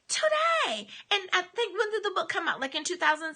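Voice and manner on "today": Today. jumps high with a falling finish; a punched, much higher Today; emphatic repeat. Real, not acted. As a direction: lift Today. sharply up then let it fall. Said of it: "emphatic repeat of today".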